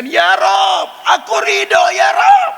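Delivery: very high, loud cry to God; shouted prayer. Acted. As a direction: throw it upward with all her strength. Mother cries out in prayer.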